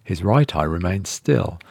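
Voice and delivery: ordinary delivery, nothing marked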